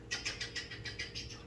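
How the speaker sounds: quiet voiceless stabbing noises; whispered rapid blade-strike effect; very soft repeated swishes by mouth; imitated sword slashes. Imitates enemies cut down.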